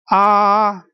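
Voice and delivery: ah held high and level; sustained ah on one steady high pitch; first tone. Shown as a demonstration. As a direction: keep ah flat all the way through. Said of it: "the sound is very flat".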